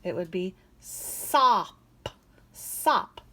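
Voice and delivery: sop said slow then breathy; blending sop. Shown as a demonstration. Teacher changing the last sound to sop. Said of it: "if I change that last sound".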